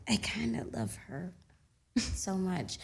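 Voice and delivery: whisper. Real, not acted. Confessing love for Angela.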